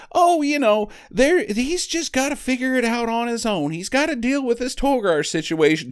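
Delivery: high, breezy imitation of the trainer; airy high voice brushing off danger; mock Noah Marshall. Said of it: "Noah Marshall's like".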